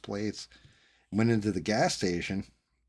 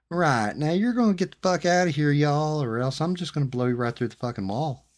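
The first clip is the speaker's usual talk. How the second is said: sweet, much higher and louder southern host making a threat; polite southern threat; 8 semitones higher, 8 dB louder than usual. Retold or imitated. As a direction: smile while you promise violence, y'all. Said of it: "they'll say it to you in a very polite way".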